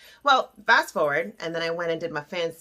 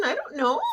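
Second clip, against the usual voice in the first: a higher, unsure "I don't know"; questioning uncertainty; 6 semitones higher than usual. Acted. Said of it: "We can hear that question".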